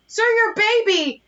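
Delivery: slow, high alarmed call to a stranger; high mock alarm. Calling to a dad with a stroller, high mock alarm. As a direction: drag it out like a fake panic.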